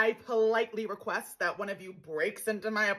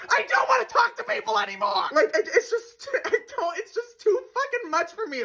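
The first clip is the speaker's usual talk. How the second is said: exasperated high; 9 semitones higher than usual. Acted. Daily scream rant.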